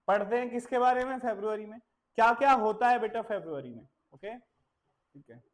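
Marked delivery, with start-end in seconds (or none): none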